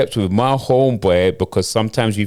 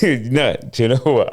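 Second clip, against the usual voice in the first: ordinary talk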